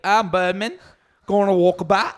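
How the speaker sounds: hammed-up Australian accent; ridiculous Australian guy. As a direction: do a silly Australian voice. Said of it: "most ridiculous voice of an Australian guy".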